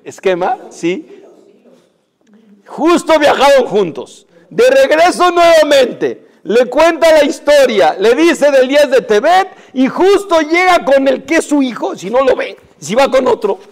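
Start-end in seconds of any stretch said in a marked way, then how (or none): 2.7-13.6 sermón fuerte, lento y más agudo; predicación enfática. Acted. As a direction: predica a la congregación con fuerza. Predica con fuerza. (el rabino predica con fuerza, despacio)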